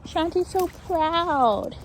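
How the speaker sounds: slow high dog praise voice; gushing praise. Real, not acted.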